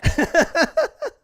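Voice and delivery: high burst of laughter; loud laugh. Real, not acted. Laughs after the quote.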